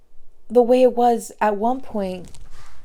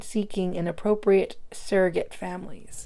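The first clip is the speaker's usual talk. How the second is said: ordinary delivery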